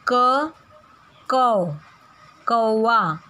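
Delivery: ordinary talk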